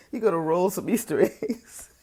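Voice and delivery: mock him like a taunt; mocking sing-song. Real, not acted. Mocking his exit line.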